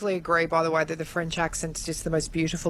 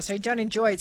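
ordinary talk

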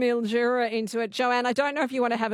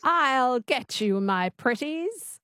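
slow, imitated witch twang; Wicked Witch; 2 semitones higher, slower than usual. Shown as a demonstration. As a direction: copy a witch voice, drawn out. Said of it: "Just say that".

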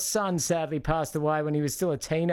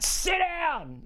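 high-pitched, drawn-out holler; mock yell; 13 semitones higher than usual. Host imitating a yell out the back door at dogs, mock yell. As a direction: yell it out like an order at a dog. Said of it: "love to go out and yell out".

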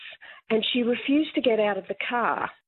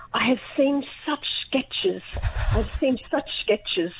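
lightly posh, slow and breathy; a hint of a prim, disapproving lady; posh grandmother voice; 4 semitones higher, slower than usual. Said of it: "in her very posh voice".

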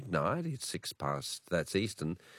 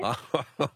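bashful laugh; 7 semitones higher, 4 dB louder than usual. Real, not acted. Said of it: "You sound very sexy tonight".

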